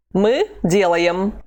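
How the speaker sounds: slow, clear drill delivery; slow model phrase. Shown as a demonstration. Teacher modelling for students, slow and clear. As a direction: lay it out slowly for the learner. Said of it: "How would you say".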